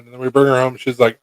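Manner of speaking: ordinary talk, nothing marked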